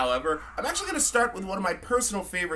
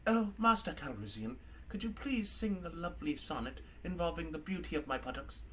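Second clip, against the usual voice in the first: very soft, prim British robot request; hushed, syrupy droid politeness; mock-polite plea; 11 dB quieter than usual. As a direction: ask softly with exaggerated courtesy. Drunk droid begs Lando, mock polite.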